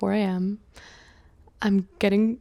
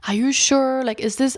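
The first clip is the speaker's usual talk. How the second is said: doubtful surprise; 5 semitones higher, 5 dB louder than usual. Retold or imitated. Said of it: "and he's like".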